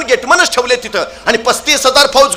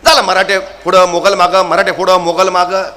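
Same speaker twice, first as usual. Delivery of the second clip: normal talking voice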